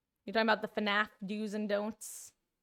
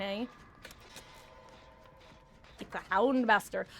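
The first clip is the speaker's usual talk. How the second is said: usual speaking voice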